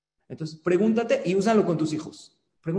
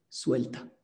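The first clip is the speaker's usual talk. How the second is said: whispered advice; 5 semitones lower, 6 dB quieter than usual. Shown as a demonstration. Teacher whispering the class's last advice to students. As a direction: whisper it, the key word of the lesson. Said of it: "¿Sabes cuál es?".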